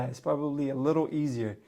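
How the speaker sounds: usual speaking voice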